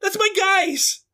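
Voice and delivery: high, thrilled shout of pride; excited cheer. Acted. Heller cheering on the team on TV, very excited. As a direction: cheer them on at a high pitch. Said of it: "He's so excited".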